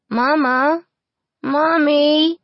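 high, loud frightened crying out; crying out for mama. A narrator's reading. Little orphan crying for mama in her sleep, high loud cry. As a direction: wake from a nightmare calling mama. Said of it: "cried Molly again".

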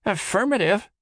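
clipped mock-soldier reply; mock militant. A narrator's reading. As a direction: play at being a drill soldier. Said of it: "Todd replied in mock militant tones".

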